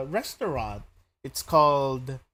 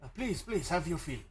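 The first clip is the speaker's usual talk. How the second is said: higher, hammed-up Italian welcome; Italian accent; 4 semitones higher than usual. Host urges guests to eat, hammed-up Italian accent. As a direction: beckon them in, playful Italian host. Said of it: "he has that spaghetti accent".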